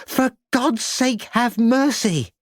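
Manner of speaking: high, slow, pleading cry; desperate plea. A narrator's reading. Lucy begging the woman for mercy, high desperate plea. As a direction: beg for the baby's life.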